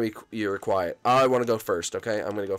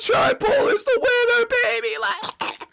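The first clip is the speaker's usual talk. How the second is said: much higher, loud whooping cheer; victory shout; 4 dB louder, slower than usual. Real, not acted. Celebrating winning the round with a loud high cheer. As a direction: hype it up like you just won.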